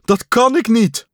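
high and loud, helpless; loud high helpless protest; distressed cry. A narrator's reading. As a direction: call out in despair. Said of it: "riep Pat".

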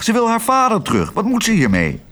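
quick, high-pitched irritated outburst; cross. A narrator's reading. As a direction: complain crossly and fast. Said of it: "riep Kwark nijdig". (nijdig, snel en hoog)

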